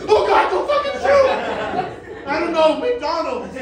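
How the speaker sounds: high, loud, laughing plea not to be shot; hammed-up terror at gunpoint, laughing; mock panic. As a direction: squeal for your life through laughter.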